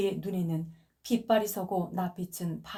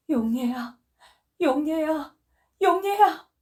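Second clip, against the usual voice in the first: from a call to a loud cry, rising higher each time; calling louder each time; 8 semitones higher, 7 dB louder, slower than usual. Acted. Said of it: "마지막 소리를 좀 크게 질렀다". (점점 커지는 부르짖음)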